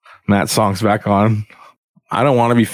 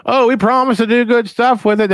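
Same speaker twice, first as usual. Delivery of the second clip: much higher sing-song voice; government promising, higher mocking voice; 13 semitones higher than usual. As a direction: mimic a smug promise. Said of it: "so they can".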